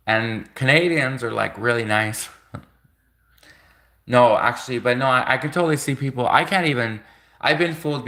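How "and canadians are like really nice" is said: laughing whisper. Real, not acted. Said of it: "giggly aside about Canadians".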